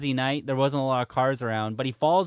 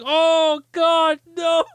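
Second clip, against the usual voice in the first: high, loud retold cry of dismay; laughing mock horror; 14 semitones higher, 7 dB louder, much slower than usual. Friends see their buddy wet himself, laughing mock dismay. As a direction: wail it as the appalled friends, cracking up.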